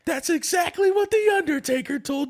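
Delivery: over-the-top wrestling hype, laughing; hype announcer. Retold or imitated. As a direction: prank caller hyping a wrestling match, high and slow. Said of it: "He's like".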